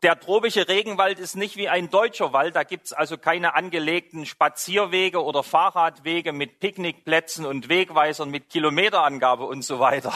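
ordinary talk

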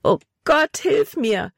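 high desperate call for help; desperate cry. A narrator's reading. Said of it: "rief sie verzweifelt".

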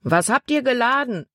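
high projected question; man calling out. A narrator's reading. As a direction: call up to the ship, pitched high, without full shouting. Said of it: "rief ein Mann".

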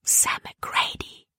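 slow, hushed whisper. A narrator's reading. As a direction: breathe the name out slowly without voice. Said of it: "flüsterte sie Caleb zu". (langsam geflüstert)

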